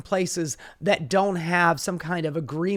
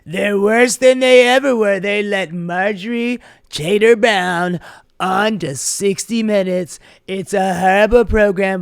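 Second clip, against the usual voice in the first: Trump impression; 2 semitones higher, 8 dB louder than usual. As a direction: rant like an angry Trump. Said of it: "Donald Trump is pissed off".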